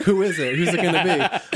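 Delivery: high, eager, laughing; bursting with anticipation; excited. Retold or imitated. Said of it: "And I'm like".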